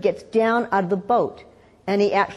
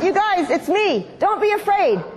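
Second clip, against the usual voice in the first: higher, urgent acted call; Jesus calling out; 9 semitones higher than usual. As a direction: call out to reassure frightened friends. Voicing Jesus calling to disciples.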